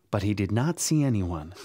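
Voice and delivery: ordinary delivery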